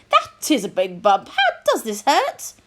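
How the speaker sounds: lightly voiced posh doctor; examine poshly, high lift on the question.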